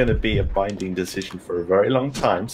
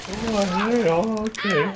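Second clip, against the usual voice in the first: high, goofy talking-dog voice; pet dog voice; 11 semitones higher, much slower than usual. Acted. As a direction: pitch it way up as the family dog. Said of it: "the voice is always like".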